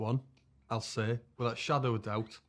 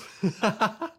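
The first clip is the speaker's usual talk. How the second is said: high laugh; 11 semitones higher, 6 dB louder than usual. Real, not acted. Laugh at low price.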